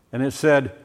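normal talking voice

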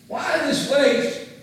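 high, loud, slow complaint; indignant outburst. Retold or imitated. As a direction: protest the waste in outrage. Disciples complain, repeated louder.

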